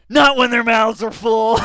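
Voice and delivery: loud, high, slow mock shout; shouted punchline. Viewer shouting a crude punchline, loud and high. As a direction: belt the line out.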